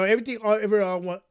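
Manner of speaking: rushed, stumbling over the title; fumbled tongue twister; tongue-tied. Real, not acted. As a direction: trip over "everything" and "all", words tumbling out jumbled. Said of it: "tongue twister there".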